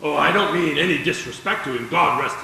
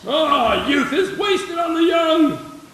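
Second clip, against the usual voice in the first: laughing shout; 11 semitones higher, slower than usual. Acted. Old neighbour mocks the young couple, laughing shout. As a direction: bellow it with a chuckle.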